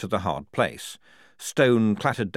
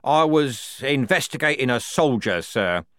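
raised, reluctant admission; 6 semitones higher, 5 dB louder than usual. A narrator's reading. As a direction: give it up unwillingly to a superior. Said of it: "said Strappy reluctantly".

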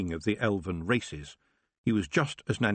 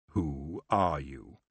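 slow, low query from a lazy king; drawn-out, lordly question; languid elf king; 4 semitones lower, much slower than usual. A narrator's reading. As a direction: ask it lazily, taking your time. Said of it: "he queried".

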